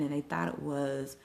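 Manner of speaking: ordinary talk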